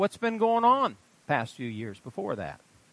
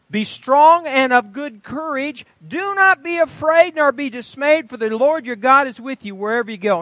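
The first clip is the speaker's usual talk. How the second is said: raised, ringing Bible reading; scripture reading; 7 semitones higher, 5 dB louder than usual. Acted. Preacher reading Joshua to the congregation, ringing proclamation. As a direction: proclaim the verses with weight.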